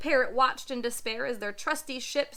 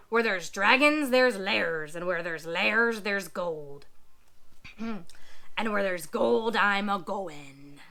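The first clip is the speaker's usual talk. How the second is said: low slow pirate drawl; old sea dog growl; gruff pirate; 5 semitones lower than usual. Acted.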